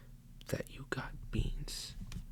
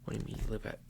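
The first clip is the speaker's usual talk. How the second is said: usual speaking voice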